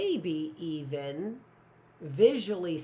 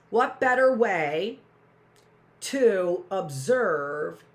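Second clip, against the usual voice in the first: dramatic slow build; 3 semitones higher, 8 dB louder, much slower than usual. Acted.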